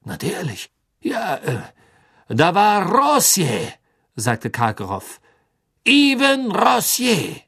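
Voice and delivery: hoch, gedehnt beteuernd; nervöser Karkaroff. Acted. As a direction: reuig bitten und beschwichtigen. Karkaroff nennt Namen. (Karkaroff beteuert dem Gericht seine Reue)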